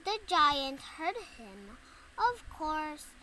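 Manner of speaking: ordinary delivery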